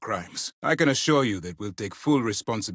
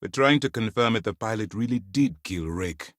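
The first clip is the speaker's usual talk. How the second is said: ordinary delivery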